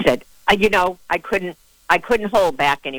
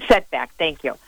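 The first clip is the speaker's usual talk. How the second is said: usual speaking voice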